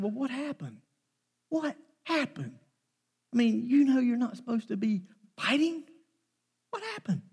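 pitched high, probing with concern; high questioning. Retold or imitated. Father asks daughter about her fight, high and probing. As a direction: ask what happened, voice climbing.

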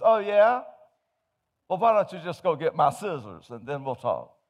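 high, loud taunting comeback; defiant wife. Acted. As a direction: dare him, shrill with defiance.

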